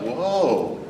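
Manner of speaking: ordinary delivery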